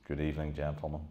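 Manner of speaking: a calm soft-spoken hint of Irish menace; a mild Irish lilt, said softly and politely; quiet Irish voice. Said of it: "uttered in a quiet Irish voice".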